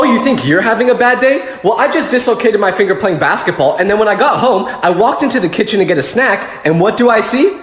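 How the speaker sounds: ordinary talk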